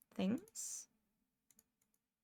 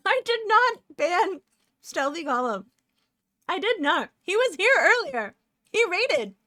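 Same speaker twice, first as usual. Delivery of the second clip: indignant protest; 9 semitones higher, 10 dB louder than usual. Real, not acted. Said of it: "denying ban to chat".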